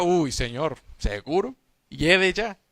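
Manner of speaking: «Uy, Señor, ¿seguro?» más bajo y suave, con duda; Marta dudosa. Acted. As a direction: titubea incrédula ante la orden. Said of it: "y Marta". (Marta titubeante, voz más baja y vacilante)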